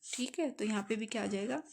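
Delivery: ordinary talk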